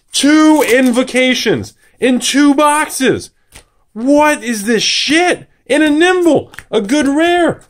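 high, drawn-out disbelief; incredulous outburst. Real, not acted. Opener tallies two invocations in two boxes, high incredulous cry. Counts the unbelievable pulls aloud.

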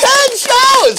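high, loud celebration; excited shout. Real, not acted. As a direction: cheer it out. Celebrating show ten.

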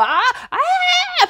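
very high mock singer's cry; imitated aggressive singing. Preacher mimicking the singer people doubted, high aggressive cry. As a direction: let out high, sustained "ah" cries in imitation. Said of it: "con esa voz".

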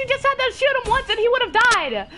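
high, fast angry rant; furious disbelief. Real, not acted. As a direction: rant rapidly at a useless teammate. Furious at teammate's missed shot.

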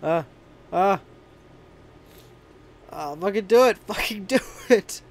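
fighting back a sneeze; building up to a sneeze; sneezing. Real, not acted. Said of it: "I'm gonna sneeze again".

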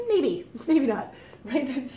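slow and laughing through it; giggling maybe not. Real, not acted. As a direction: tease the answer with a laugh. Marriage proposal joke.

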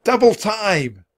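high, loud, slow drawn-out call; urgent shout. A narrator's reading. Jack hurrying Annie along, shouting. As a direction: shout it out long, urging speed. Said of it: "Jack shouted to Annie".

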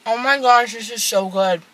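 speaking between bites; talking while chewing. Real, not acted. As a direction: mouth partly full of food. Seen 0: Fed herself at -1 s; mouth keeps chewing while speaking.

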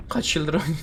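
ordinary delivery

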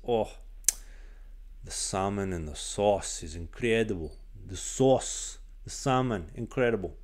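lightly put-on Eastern European accent, rising on salmon; the stern coach gushing about salmon in a slight Eastern European accent; a hint of an Eastern European accent, curious. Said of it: "had a very thick Eastern European accent".

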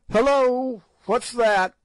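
high loud startled call in the dark; Good crying out as bats hit him; startled halloo. A narrator's reading. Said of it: "hallooed Good".

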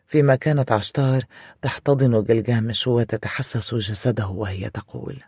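plain reading voice

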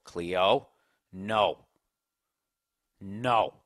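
low, spaced-out warning to a dog; retold stern command, each word set apart; firm dog command. Owner correcting his dog Cleo, low firm command.

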